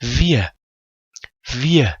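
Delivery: Wir said as "vi-e", final r a vowel; model pronunciation. Shown as a demonstration. Teacher has learners pronounce Wir, clearly modelled without the r. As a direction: repeat Wir separately, falling, with no consonant r. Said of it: "تلفظ کنید".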